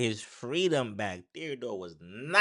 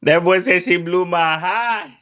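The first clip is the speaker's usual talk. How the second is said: menirukan dengan nada tinggi dan keras; mocking quote; 9 semitones higher, 10 dB louder than usual. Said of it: "She tells me".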